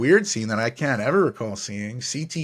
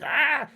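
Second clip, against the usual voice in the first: put-on startled kid shriek. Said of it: "kid standing in the elevator like".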